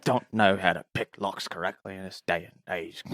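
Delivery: a hint of sleepy low muttering; sleep-talking. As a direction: complain as if half asleep. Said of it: "Archie rolls over in his sleep".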